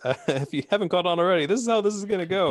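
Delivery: giggling through high, fast words; can't stop laughing while talking. Real, not acted. Cracking up mid-announcement.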